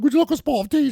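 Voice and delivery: dad teasing his daughter in a hammed-up British accent; mock British accent. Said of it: "I do that to my daughter".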